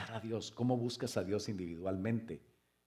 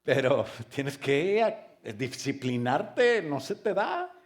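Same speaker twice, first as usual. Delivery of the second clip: preaching at full force; 3 semitones higher, 7 dB louder than usual. Acted. Pastor urging discipline on his church, preaching at full force. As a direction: drive it home with force.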